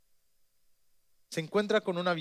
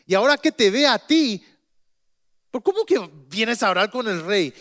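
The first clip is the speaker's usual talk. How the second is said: higher, louder frightened urgency; alarmed warning; 5 semitones higher, 5 dB louder than usual. Acted. The steward warns the prophet in loud alarm. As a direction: warn him the king will kill him.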